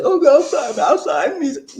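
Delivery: high, loud, rushed fake pleading; hammed-up panicky apology of a punk; mock cowardly punk. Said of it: "if I was a punk".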